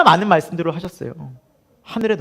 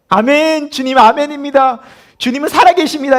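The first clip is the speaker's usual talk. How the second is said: higher, louder, fervent amen; eager amen; 7 semitones higher, 7 dB louder than usual. Retold or imitated. Believer loudly proclaiming faith in church, fervent and high. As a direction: shout amen like a zealous believer.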